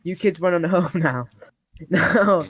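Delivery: normal talking voice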